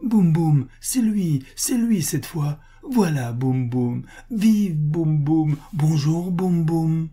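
put-on high, loud, jubilant child; overjoyed little boy hailing his hero; seven-year-old's glee. A narrator's reading. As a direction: burst out cheering like a rocket. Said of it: "dit avec sa gaieté de sept ans".

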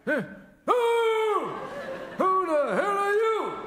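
high, loud jolted yelp; confused old man woken with a start; startled awake. Acted. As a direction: snap out of sleep shouting.

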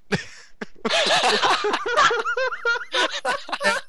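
high laughing fit; long laugh. Real, not acted. Funny dream punchline.

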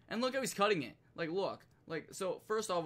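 usual speaking voice

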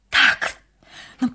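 high, shaky, fearful voice; distraught, near tears. Acted. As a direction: cry out in confused panic. Nun distraught, denies memory.

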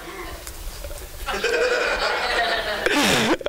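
soft breathy laugh; amused laugh after the joke; laughter. Real, not acted. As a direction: laugh at your own bit. Laughs at own impression.

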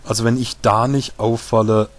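usual speaking voice

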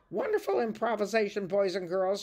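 exclaiming teacher. Acted. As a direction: gush to cover the mishap. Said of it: "she exclaimed".

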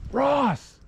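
high call across the river. Real, not acted. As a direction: yell his name to a friend far downstream. Calling friend downriver.

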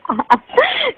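a sudden high-pitched laugh; embarrassed giggle at the crude joke; high burst of laughter. Real, not acted.

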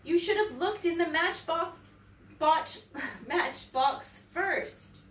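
a hint of a child telling mother off; little boy. A narrator's reading. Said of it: "said the little boy".

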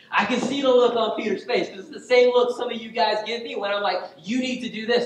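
loud, high-pitched pulpit delivery; animated preaching. Real, not acted. Preacher teasing the congregation about their look, animated and loud. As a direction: drive it out to the congregation, high and forceful.

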